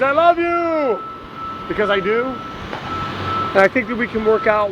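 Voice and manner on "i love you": screaming it out. Real, not acted. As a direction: belt it out from the top of your lungs. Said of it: "I'll scream it from the top of my lungs".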